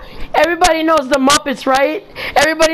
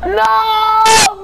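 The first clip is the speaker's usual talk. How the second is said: usual speaking voice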